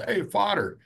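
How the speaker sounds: high pleading call up out of a hole; calling for help. Retold or imitated. Stuck man calls up to the priest, high and pleading. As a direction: shout up to the passing priest for help.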